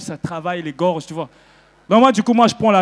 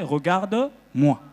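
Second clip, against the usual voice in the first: drawn out «Regarde-moi», slowly; slow translation; 2 semitones lower, much slower than usual. Shown as a demonstration. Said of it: "ça veut dire en français".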